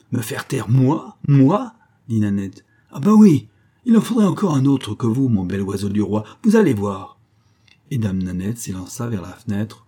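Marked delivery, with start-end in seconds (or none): 0.1-1.7 outraged question climbing higher and louder; mounting indignation; indignant. A narrator's reading. Servant outraged at being silenced. 2.9-7.2 defiant outburst, high and loud at first; defiant servant taunting an officer. A narrator's reading.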